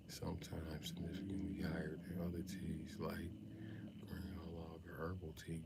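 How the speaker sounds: hushed unvoiced whisper, slow at the start; whispered reading. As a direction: whisper the fact like a secret. Reads fact in whisper.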